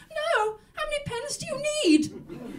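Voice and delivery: high, startled cry of disbelief; shocked. Retold or imitated. An old lady on the phone to her bank, shocked and high. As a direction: yelp it out in high alarm, then slow. Wife voicing herself to bank.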